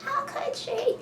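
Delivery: very high, wailing outrage; a high indignant wail; betrayed wail. Retold or imitated. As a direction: cry out that she left you. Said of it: "I was so pissed".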